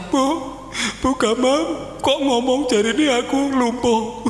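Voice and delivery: wailing a little, played for laughs; tearful phone call. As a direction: imitate a distressed husband on the phone. Phones wife in distress about diagnosis.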